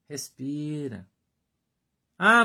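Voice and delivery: usual speaking voice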